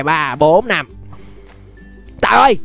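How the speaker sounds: ordinary talk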